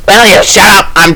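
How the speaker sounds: high raspy snap; raspy smoker voice. Acted. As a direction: rasp it out like a smoker. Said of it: "a raspy smoker voice".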